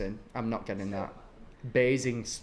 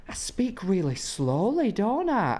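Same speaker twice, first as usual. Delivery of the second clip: higher, slow mock of mum's drawl; slow northern mum; 7 semitones higher, slower than usual. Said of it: "My mum's exact words were".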